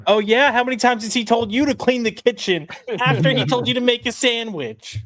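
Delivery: high, loud mocking argument voice; mock shouting match. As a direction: shout it back in a high parody voice.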